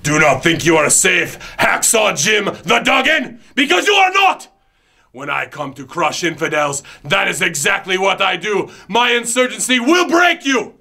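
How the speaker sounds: booming villain proclaiming to infidels; megalomaniac rant. Acted. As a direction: preach your threats like a fanatic leader. Character promo rant.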